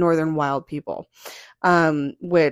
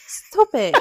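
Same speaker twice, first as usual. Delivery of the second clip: louder, higher mock "stop it"; mock protest; 7 semitones higher, 4 dB louder than usual. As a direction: playfully protest at being mocked. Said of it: "He's making fun of me".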